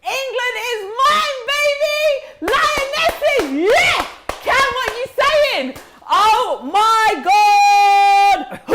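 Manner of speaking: high, loud cries of triumph; ecstatic shouting. Real, not acted. Host screaming about getting England, ecstatic. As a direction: scream it like a goal just went in. Celebrating England draw on stream.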